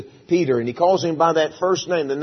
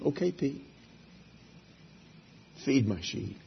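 Jesus softly reassuring Peter; gentle reply; 10 dB quieter than usual. Acted. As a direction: say it kindly to a broken friend.

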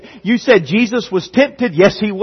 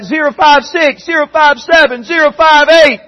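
high count building louder; muster call-out; 9 semitones higher, 4 dB louder than usual. Acted. Recruits sounding off at muster, rising shouted count. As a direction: call out numbers in climbing military roll call.

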